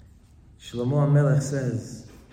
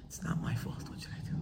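whispered mock self-pity; whiny victim whisper; 14 dB quieter, faster than usual. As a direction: hush it like a sulking victim.

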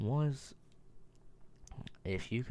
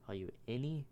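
ordinary talk

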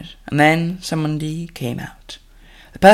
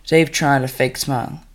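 ordinary delivery, nothing marked

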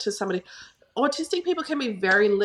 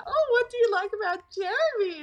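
bright curious mum; 12 semitones higher than usual. Retold or imitated. Mum asking son about crush.

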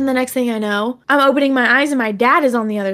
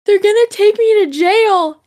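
high, louder distraught wail; mock sobbing; 9 semitones higher, 4 dB louder, slower than usual. Daughter to dad in the car, hammed-up sobbing wail. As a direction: act out the drugged kid crying. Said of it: "I was just crying hysterically".

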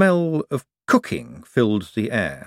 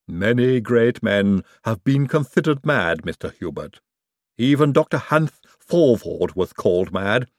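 Igor voice. Acted. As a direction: plead the case like a loyal servant. Igor reassures Hubert.

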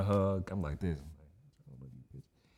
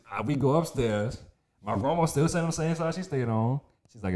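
ordinary talk

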